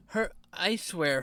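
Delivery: ordinary delivery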